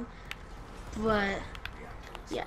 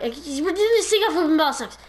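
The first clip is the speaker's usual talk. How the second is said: excited mock answer; 6 semitones higher, 8 dB louder than usual. Kids answering in retold scene.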